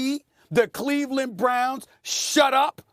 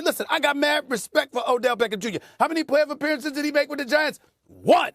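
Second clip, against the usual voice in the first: normal talking voice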